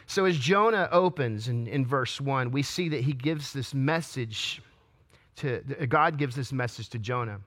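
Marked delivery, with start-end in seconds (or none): none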